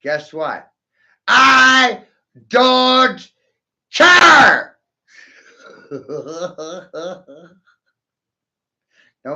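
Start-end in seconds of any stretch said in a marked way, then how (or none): 1.3-4.8 high, very loud, one word at a time; defiant shout. Real, not acted. Defiant answer to critics, yelled. 5.6-7.4 soft, drawn-out chuckle; laugh. Real, not acted. Laughs after own shouted line.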